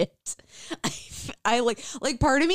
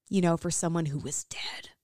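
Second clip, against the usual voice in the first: whispering; 6 semitones lower, 6 dB quieter than usual. Acted. Whispered joke aside in reading.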